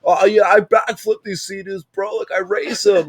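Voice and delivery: high, hyped surfer-bro voice; surfer guy. Retold or imitated. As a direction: brag like a pumped surfer dude. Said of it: "fully surfer guy".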